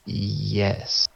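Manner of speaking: drawn-out, deliberate yes; pointed confirmation. Retold or imitated. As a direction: answer a doubter with careful emphasis. Deliberate answer to doubting nurse.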